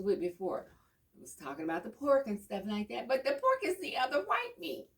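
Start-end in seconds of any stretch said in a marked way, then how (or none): 3.1-4.9 mock ad slogan. Quoting pork ad slogan.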